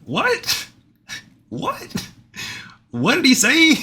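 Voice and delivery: very high-pitched squeal of disbelief; incredulous disbelief. Real, not acted. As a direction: squeal it like you can't believe your ears. Reacting in disbelief to played clip.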